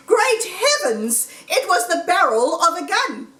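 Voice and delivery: sudden storyteller alarm; alarmed surprise. A narrator's reading. Fox spots the gun.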